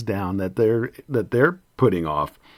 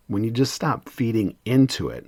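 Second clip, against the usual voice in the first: ordinary delivery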